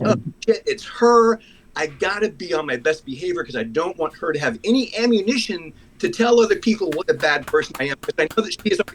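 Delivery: panicking at the sight of a disliked neighbor; retold oh-no moment, pitched high; panicked inner voice. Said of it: "I, like, instantaneous, like".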